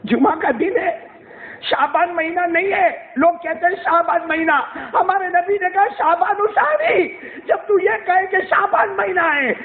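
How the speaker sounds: fervent preacher pleading; impassioned preaching. Acted. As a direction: press the point hard to the listeners. Preaching rises again.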